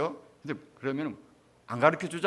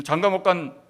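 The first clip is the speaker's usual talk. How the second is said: put-on loud, higher-pitched Gyeongsang-accented plea; Gyeongsang dialect; 5 semitones higher, 8 dB louder than usual. Relative pleading with the dead at ancestral rites, loud Gyeongsang dialect. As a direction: imitate a relative praying in Gyeongsang dialect, loudly. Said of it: "경상도 사투리로 그래 하는 거예요".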